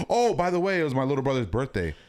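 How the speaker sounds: mock sarcastic jab. Friends ribbing Jake about a forgotten birthday, sarcastic. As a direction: needle him with fake casualness. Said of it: "we all got on his ass".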